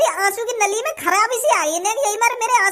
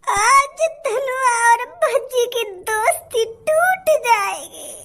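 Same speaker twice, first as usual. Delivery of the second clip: slow, hammed-up sobbing wail; milk the tears with a drawn-out mock sob; fake crying; slower than usual. Friend mourns Bhajji's death sentence with hammed-up sobbing. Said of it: "काय को नाटक कर रहा तू मेरे सामने रोने का".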